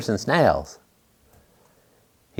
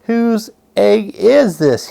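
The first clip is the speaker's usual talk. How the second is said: slow, much higher call across the path; 8 semitones higher, slower than usual. A narrator's reading. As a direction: call out to whoever owns the egg. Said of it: "he called".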